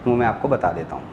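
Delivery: ordinary talk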